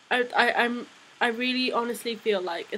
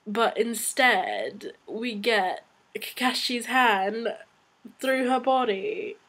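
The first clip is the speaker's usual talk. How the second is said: dramatic slow reveal; slower than usual. Real, not acted.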